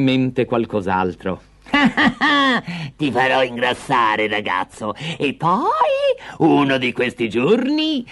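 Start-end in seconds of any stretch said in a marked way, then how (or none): 1.7-8.1 gloating hag promising to roast him; menacing witch. Acted. The witch tells Hansel she will eat him, gloating.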